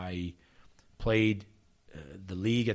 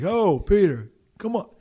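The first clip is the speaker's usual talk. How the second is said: imitated little Italian guy, high and loud; Frankie; 12 semitones higher, 10 dB louder, slower than usual. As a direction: call out insistently, mimicking an Italian teammate. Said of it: "Frankie is a little Italian guy".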